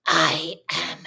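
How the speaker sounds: low, slow, whispery menace building to the last word; hushed evil granny, each word spaced out. Retold or imitated. Granny correcting Nash after sunset, evil Granny voice. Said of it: "evil Granny at six thirty-five P M".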